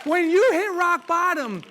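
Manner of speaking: loud, high and slow; shouted rebuttal. Real, not acted. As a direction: hammer each word home.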